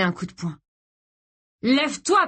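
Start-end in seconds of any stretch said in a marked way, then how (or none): 1.6-2.3 higher and louder furious yell; yelled order. Acted. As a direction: scream it at him, losing control. Mark repeating order, enraged.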